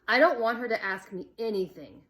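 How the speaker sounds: loud sharp snap; snapped. A narrator's reading. Boy snapping at the girls, sharp and irritated. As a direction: cut her off, irritated. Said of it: "Jeff snapped".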